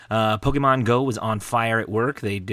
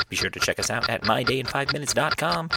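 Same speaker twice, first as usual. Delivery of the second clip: ordinary delivery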